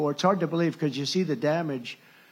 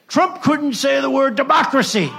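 high, loud shouted mock of reporters; mocking the press; 9 semitones higher, 8 dB louder than usual. Speaker mocking the press's headline, loud and high. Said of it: "and they say".

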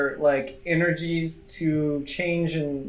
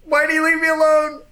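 scared guard; 12 semitones higher, 4 dB louder than usual. Acted. Abandoned guard panics.

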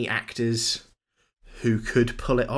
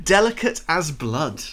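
mock savouring; 5 semitones higher, 5 dB louder than usual. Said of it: "echo of quote".

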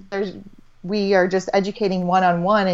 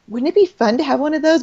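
higher, light and playful; dreamy wishful question, higher; 7 semitones higher than usual. Retold or imitated.

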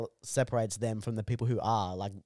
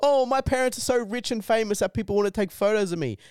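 put-on much higher, louder spoiled-child voice; mocking whiny rich kid; 9 semitones higher, 5 dB louder than usual. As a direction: sneer at the poor little rich kid.

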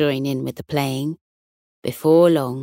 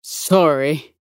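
sheepish bulldog; 2 semitones lower than usual. Acted. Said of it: "with a sheepish grin".

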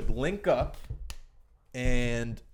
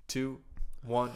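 ordinary delivery

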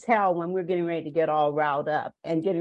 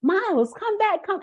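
retold yell; 12 semitones higher than usual. Grandmother shouting after grandson running off, high yell. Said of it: "I'm just yelling at him".

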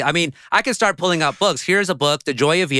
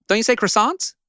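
usual speaking voice

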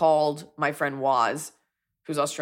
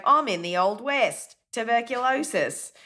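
Australian character; 6 semitones higher, slower than usual. Acted. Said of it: "She's like".

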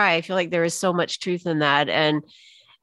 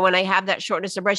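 usual speaking voice

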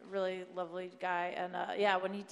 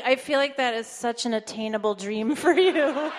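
laughing retelling; 3 semitones higher, 12 dB louder than usual. Retold or imitated. Amy's deadpan reply retold.

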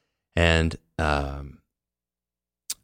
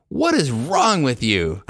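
disgusted stranger; 8 semitones higher, much slower than usual. Retold or imitated. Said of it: "she says".

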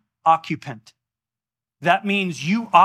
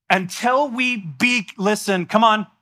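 higher, slow and emphatic; building preaching; 5 semitones higher, slower than usual. Real, not acted. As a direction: preach it home with rising force.

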